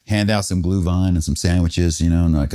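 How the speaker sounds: ordinary delivery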